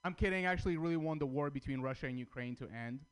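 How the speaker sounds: normal talking voice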